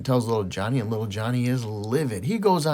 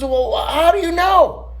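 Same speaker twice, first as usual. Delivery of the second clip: incredulous mom; 6 dB louder, slower than usual. Acted. Mother questions son.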